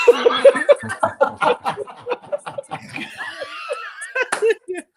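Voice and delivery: high, loud shrieking laugh. Real, not acted. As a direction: cackle hard at your own joke. Punchline reaction.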